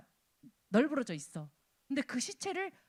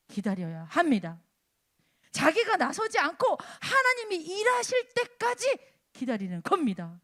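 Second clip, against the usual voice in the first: forceful preaching; 5 semitones higher, 7 dB louder than usual. Acted. Preacher challenging congregation to wait for God, forceful preaching. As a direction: hammer out questions, then land the verdict firmly.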